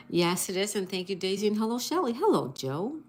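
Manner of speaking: ordinary talk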